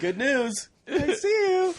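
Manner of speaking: laughing mock doctor. Laughing at the ICU bit, mock doctor saying I see you. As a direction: choke out a high, slow I see you through laughter.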